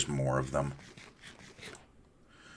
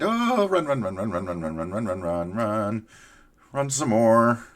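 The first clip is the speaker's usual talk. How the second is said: being chased; 4 semitones higher than usual. Real, not acted. Mob behind, running away.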